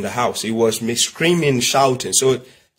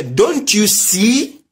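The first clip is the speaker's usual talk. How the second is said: very slow, drawn-out question, higher in pitch; pointed question; 7 semitones higher, much slower than usual. Acted. Asking someone searching for the pen he holds, slow pointed question. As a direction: stretch each word and rise at the end. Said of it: "The moment you just say".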